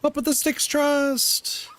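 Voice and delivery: high, slow rallying shout; excited cheer. Real, not acted. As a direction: pump up the team, drawn out. Hyped rallying cry.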